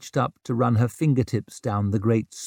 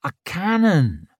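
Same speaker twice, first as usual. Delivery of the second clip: sharp high surprised echo; 7 semitones higher than usual. A narrator's reading. Ricker echoes in surprise.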